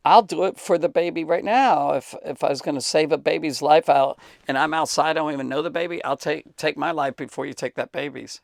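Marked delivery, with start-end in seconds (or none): none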